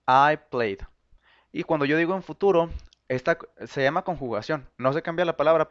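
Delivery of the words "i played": I played said out louder; the example I played, louder; clear model phrase. Shown as a demonstration. Teacher modelling the past tense for the class. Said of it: "le agregamos una E D".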